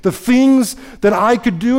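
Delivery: a son marvelling to his Father, hands up; joyful Jesus praying. Retold or imitated. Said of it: "he's happy and he's like".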